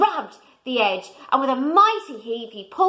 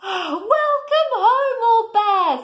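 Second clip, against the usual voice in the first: tender welcome; 11 semitones higher, 5 dB louder, much slower than usual. A narrator's reading. Bramwell's emotional welcome.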